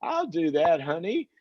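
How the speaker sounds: high, breezy fake agreement from a husband; a husband humoring his wife, high and airy; mock agreeable husband. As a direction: play the husband brushing his wife off sweetly. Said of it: "That's like when a husband".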